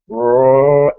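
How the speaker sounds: long, loud zombie growl; imitated zombie roar. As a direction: roar like a distant horde. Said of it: "And, and then we hear".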